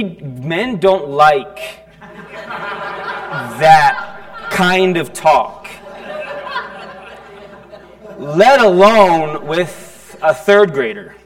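slow words broken by real laughter; amused, drawn-out laughing delivery. Real, not acted. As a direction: laugh through the awkward admission. Laughing at his own awkward story.

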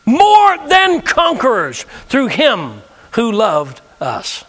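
slow, stretched, ringing declaration; preaching proclamation. Acted. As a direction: proclaim it over the crowd.

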